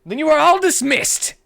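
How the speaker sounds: high, loud barked orders; drill sergeant. Acted. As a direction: bark the dismissal. Commander barks dismissal.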